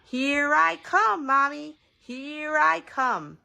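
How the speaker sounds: put-on loud, high, drawn-out kid's call; sing-out holler to Mommy; crying out. A narrator's reading. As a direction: call out gleefully on stretched words. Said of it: "Petey ran into the living room crying".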